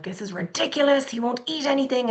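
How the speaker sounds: high-pitched retold exasperated panic; freaking out. Mom freaking out in the doctor's, high frantic complaint. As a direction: replay a frantic worried mom, pitched well up. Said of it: "freaking out".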